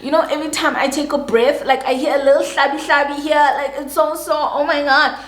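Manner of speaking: high, put-on pained whine; theatrical moaning and wincing. Faking pain for her man, mock moaning and wincing. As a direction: ham up the aches with every breath. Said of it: "So I'm moaning, I'm wincing, I'm doing the theatric".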